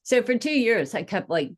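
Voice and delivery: usual speaking voice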